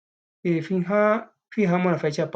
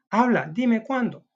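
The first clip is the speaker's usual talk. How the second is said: demanding; 8 semitones higher, 4 dB louder, slower than usual. Acted. Woman demanding answer from man.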